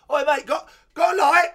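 high, very loud imitation of a holler; shouted workman's call. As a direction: shout it across the street. Re-enacting workmen shouting to him.

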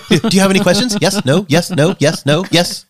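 hammed-up rushed tour guide; pressured rushing speech. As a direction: rattle it off breathless. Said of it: "talking a mile a minute".

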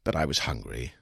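ordinary delivery, nothing marked

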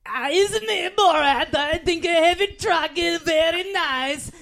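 put-on high, slow comic foreign accent; Borat. Performing a booked cameo message for Joel, Borat. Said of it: "He wants an impression of Borat".

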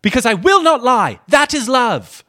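high, loud, overplayed scolding; hammed-up Lady Sarah. Friend hams up Lady Sarah's reply, a high loud scold. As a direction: deliver it big and theatrical. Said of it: "Lady Sarah".

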